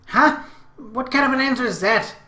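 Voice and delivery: high, loud, indignant little-sister voice; exasperated outburst from a younger sister; irritated. A narrator's reading. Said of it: "I could hear irritation in her voice".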